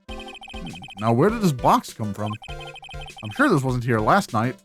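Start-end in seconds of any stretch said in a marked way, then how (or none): none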